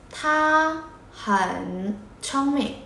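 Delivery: each word of "Tha hẳn chong mẹ" drawn out separately; very slow model. Shown as a demonstration. Teacher modelling the phrase for learners, very slow and separated. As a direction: stretch "Tha" and "hẳn" long, pausing between words.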